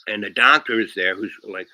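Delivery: ordinary talk, nothing marked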